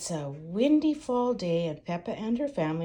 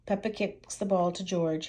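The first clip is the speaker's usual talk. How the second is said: ordinary delivery, nothing marked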